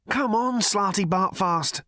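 high, anxious urging; nervous. A narrator's reading. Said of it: "said Ford nervously".

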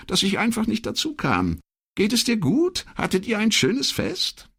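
a chatty, friendly wife on the phone; older woman's voice. Acted.

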